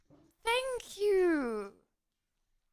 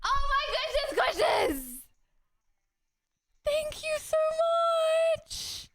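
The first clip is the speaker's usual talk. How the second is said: overwhelmed thanks; 9 semitones higher than usual. Real, not acted. Thanking for hundred gifted subs.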